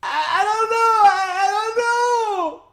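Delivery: high, loud mock crying wail; crying daughter. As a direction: sob it out in a high voice. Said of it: "she's sitting there telling me".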